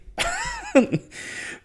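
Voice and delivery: real laugh shooting up high; high burst of laughter. Real, not acted. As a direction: let out a sudden high laugh. Laughs at own joke.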